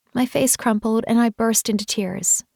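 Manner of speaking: plain reading voice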